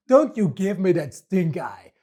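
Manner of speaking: ordinary talk, nothing marked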